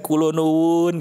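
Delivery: gentle, drawn-out courteous greeting; soft-spoken Javanese politeness. Shown as a demonstration. Demonstrating a Central Javanese friend's greeting, polite and smooth. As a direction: imitate a refined Central Javanese caller, even and smooth. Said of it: "Dia ngomongnya halus kan".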